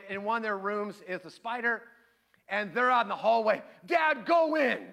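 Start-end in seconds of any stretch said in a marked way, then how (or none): 3.9-4.8 high, loud mock order to Dad; raised, pushy kid-style yell; imitated kids' shout. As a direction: call out urgently like kids in a hallway. Kids send dad in from hallway.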